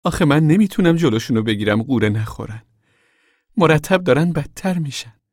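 mild plaintive wail; a touch of a whine. A narrator's reading. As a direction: complain helplessly, voice lifting at the end. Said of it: "همان صدا دوباره به ناله بلند شد".

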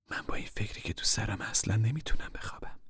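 soft worried whisper. Acted. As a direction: whisper that she cannot sleep. Mother whispers her worry.

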